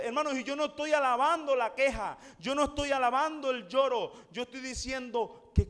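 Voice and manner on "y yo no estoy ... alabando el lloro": ordinary delivery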